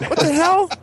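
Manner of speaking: high squeaky startled cry; shocked mid-pee, voice jumps way up; startled what the hell. Retold or imitated. Guy finding he peed on the lid, startled high cry. Peeing on closed toilet lid, surprised.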